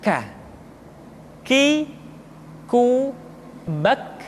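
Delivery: aspirated k in "K. Ki, ku, bak"; souffle qui coule. Shown as a demonstration. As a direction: let breath flow after each k in "K. Ki, ku, bak". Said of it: "diucapkan dengan mengalirkan nafas kita".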